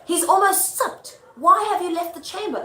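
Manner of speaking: high, loud stage reproach; Lady Macbeth. Acted. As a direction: scold him like an impatient stage wife. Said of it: "And she goes".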